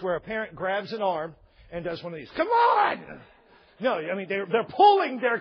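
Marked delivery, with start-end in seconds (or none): 2.3-3.2 high, loud parental shout; acted outburst at a dragging child; exasperated yell. Parent grabs child's arm, exasperated high shout.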